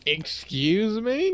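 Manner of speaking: high, drawn-out incredulous "excuse me"; loud, rising show of offence; mock indignation. Player teased over rookie cash numbers, mock indignant.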